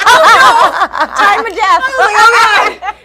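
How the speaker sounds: high, loud laughing mock panic. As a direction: squeal it out through laughter. Laughing panic between fighting dogs.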